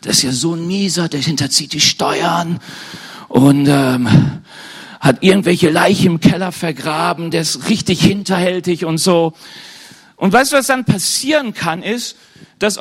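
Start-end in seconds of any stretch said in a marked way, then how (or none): 0.0-8.8 lightly voiced rumour-monger; a hint of someone spreading rumours; gossiping acquaintance. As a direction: pass on damning gossip as if confiding. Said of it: "kommt irgendjemand zu dir und sagt".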